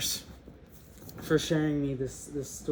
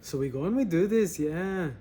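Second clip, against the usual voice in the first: higher, louder, badly faked Mexican accent; attempted Mexican accent; 3 semitones higher, 6 dB louder than usual. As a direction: fumble loudly for a Mexican accent. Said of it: "how do I do a Mexican accent?".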